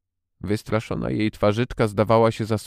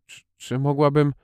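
put-on a girl's eager stammer, pitched higher; 6 semitones higher, 3 dB louder than usual. A narrator's reading. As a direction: trip over „Czy, czy” before daring to ask. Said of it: "rzekła jąkając się Mary". (nieśmiałe, podniesione jąkanie)

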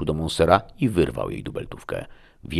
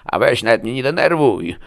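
high, loud exasperation; loud, high-pitched irritated comeback; exasperated retort; 8 semitones higher, 8 dB louder than usual. A narrator's reading. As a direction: fend off the question irritably, pitched up. Wounded man answers in exasperation.

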